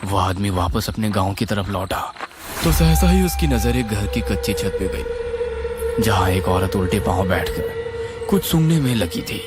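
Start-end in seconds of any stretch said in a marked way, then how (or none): none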